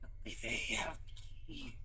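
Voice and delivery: whispered insect voice with a lisp; a weak, lisping whisper of a bug. Acted. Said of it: "Imagine a bug voice with a lisp".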